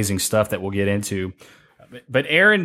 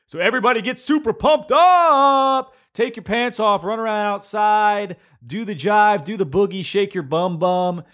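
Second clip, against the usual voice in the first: pumped up; 8 semitones higher than usual. Acted. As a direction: whip the crowd into a frenzy. Pumping up listeners.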